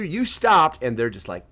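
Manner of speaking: ordinary delivery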